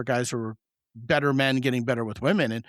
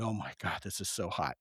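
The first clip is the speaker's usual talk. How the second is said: much softer, lower aside; imitated whispering; 5 semitones lower, 12 dB quieter than usual. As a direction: imitate someone whispering, hushed and low. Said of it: "like, whispering".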